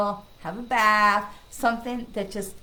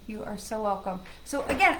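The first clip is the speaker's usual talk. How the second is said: usual speaking voice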